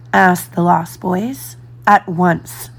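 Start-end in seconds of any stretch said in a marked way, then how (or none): none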